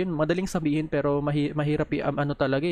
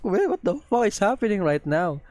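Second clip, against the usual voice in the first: high, stunned outburst at what he sees; can't believe what's happening; shocked disbelief; 5 semitones higher than usual. Real, not acted. Vlogger reacting to pictures in shocked disbelief.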